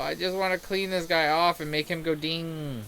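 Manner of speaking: normal talking voice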